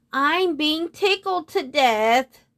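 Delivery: high, slow wail of complaint; begging. A narrator's reading. Moon being tickled to death by the unicorn, begging. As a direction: stretch it out in helpless protest. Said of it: "begged the moon".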